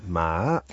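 MA dipping, then climbing; third tone attempt. Shown as a demonstration. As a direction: try "Ma" going down and then up. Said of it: "So down and then up".

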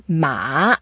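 MA dipping down, then rising; third tone. Shown as a demonstration. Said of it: "go down a bit and then up".

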